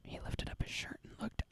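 hushed whispered report. Real, not acted. Host telling co-host what the intruder did, whispering. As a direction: whisper it like scandalous news.

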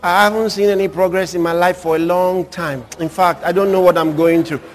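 low, dejected imitation; mock downcast grumbling; discouraged complainer. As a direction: drone it low like someone stuck and giving up. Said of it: "some people say".

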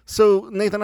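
high, loud call to Nathan. Real, not acted. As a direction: grab his attention with his name. Host calls name loudly.

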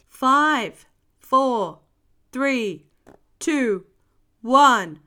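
loud, evenly spaced counting; dramatic storybook countdown; launch countdown. A narrator's reading. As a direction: count down to lift-off, punching each number.

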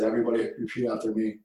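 low urgent call to the huddle; hushed, intense command. Retold or imitated. Coach quietly orders the team to repeat his words, hushed. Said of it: "I said it just like that".

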